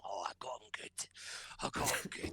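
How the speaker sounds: smug gloating whisper of the manager; sneering mock victory whisper. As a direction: gloat like a petty manager who won.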